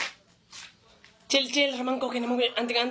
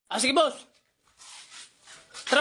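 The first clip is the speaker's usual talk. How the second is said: ordinary talk, nothing marked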